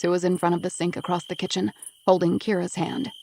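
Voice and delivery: ordinary talk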